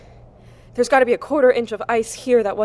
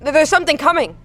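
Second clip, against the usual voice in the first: higher, louder panicked stammer; rising fear; 6 semitones higher, 4 dB louder than usual. Acted. Stranded woman hearing something approach, high and frightened. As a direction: fear breaking through as it nears.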